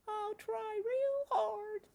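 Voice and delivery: high, slow, teary little spider; mock-weepy spider vowing to be good; puppet spider. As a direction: promise it like a sad little puppet. Spider promises teacher.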